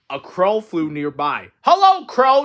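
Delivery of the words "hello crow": high, loud greeting; shouting. A narrator's reading. Toad shouting to the crow, high and loud. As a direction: call out proudly to the bird. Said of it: "shouted Toad".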